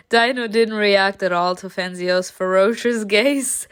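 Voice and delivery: plain reading voice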